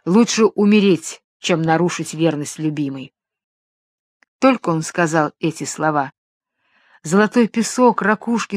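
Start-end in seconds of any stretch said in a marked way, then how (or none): none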